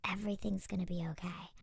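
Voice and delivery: hushed, breathy reassurance; soft whisper. Retold or imitated. Remembering childhood comfort, whispering it softly. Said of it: "that feeling of being like".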